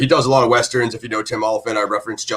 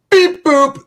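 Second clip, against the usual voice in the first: droid beeps; 6 dB louder than usual. Pretend. Droid noise in Star Wars intro.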